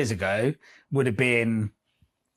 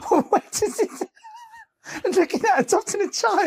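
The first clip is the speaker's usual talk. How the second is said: laughing disbelief. Real, not acted.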